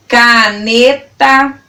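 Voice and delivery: CANETA slowly sounded out as ca-ne-ta; syllable by syllable. Shown as a demonstration. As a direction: stretch each syllable of CANETA. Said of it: "falando elas pausadamente pra você".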